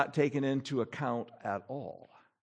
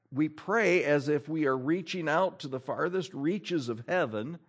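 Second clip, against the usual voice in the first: ordinary talk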